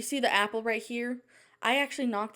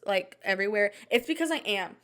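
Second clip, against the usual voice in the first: ordinary talk, nothing marked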